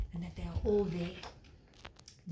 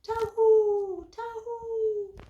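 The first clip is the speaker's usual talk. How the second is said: shepherd's call; 12 semitones higher, 6 dB louder, much slower than usual. Acted. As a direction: call the flock in, then ease off. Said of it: "the shepherd goes".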